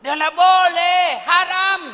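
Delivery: high, loud and slow refusal; shouted high refusal; firm loud refusal. Acted. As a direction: push her away with a loud no. Yusuf refusing temptress.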